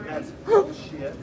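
slow, loud, furious; angry outburst. Real, not acted. Drunk passenger yelling at officer about being denied boarding, furious. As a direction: spit it out at the officer, drawn out. Drunk man arguing with police.

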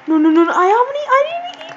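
rising, high, loud wail; frantic high objection; panicked protest. Real, not acted. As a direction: climb to a squeal of protest. Protesting game mishap.

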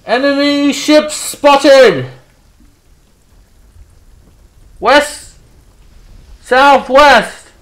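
high, drawn-out full shout; lookout's yell. Acted. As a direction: yell an alarm from the lookout post. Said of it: "the lookout yells out".

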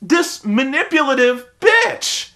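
high, emphatic mock outrage; hammed-up exasperated insult; indignant. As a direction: spit out each word in disgust. Retells indignant reaction to Bella.

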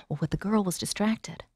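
ordinary delivery, nothing marked

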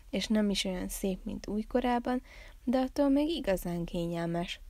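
ordinary delivery

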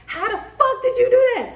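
high-pitched incredulous question; acted amazement. Amazed onlooker reacting to your impossible win, incredulous. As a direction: voice leaps up in mock disbelief.

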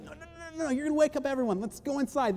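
high-pitched, hurried mock panic; acted-out frantic plea to keep it down; urgent hushing. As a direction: shush them in a squeaky rush. Peter hushing the excited group.

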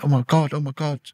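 high-pitched disbelief, quoting someone else; retold astonishment. Acting out the astonished nurse.

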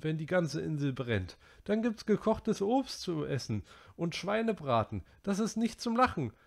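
put-on high boy's voice, worked up; Piggy scolding. A narrator's reading. Piggy berating the boys over the island fire, high and worked up. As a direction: rant at the careless boys.

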